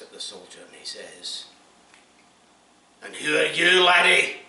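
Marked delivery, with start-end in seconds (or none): 3.0-4.4 loud, high drill sergeant challenge. Acted. Sergeant confronts a soldier on parade, loud and high. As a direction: bark it across the parade ground.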